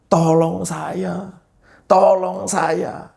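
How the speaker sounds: high, drawn-out cry for help; acted-out begging, long stretched words; desperate plea. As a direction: plead slowly, pitched well up, as if begging to be saved. Acting out his fearful plea.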